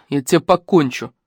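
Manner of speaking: ordinary delivery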